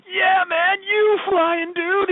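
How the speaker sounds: very high, drawn-out mock dude voice; stoner guy. City guy answering Krillin, high slow mock dude. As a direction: stretch it out like a dazed dude.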